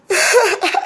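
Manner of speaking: short high-pitched laugh; high giggle. Real, not acted. Laughs after greeting.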